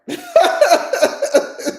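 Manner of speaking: bright burst of real laughter. Real, not acted. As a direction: laugh at herself. Laughs about being a crier.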